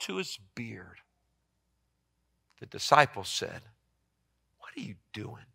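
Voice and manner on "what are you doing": whisper. Acted. Disciples questioning Jesus, voiced.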